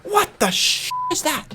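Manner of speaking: high, drawn-out baffled question; startled disbelief. Real, not acted. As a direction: blurt it out, thrown by a sudden noise. Startled by noise outside.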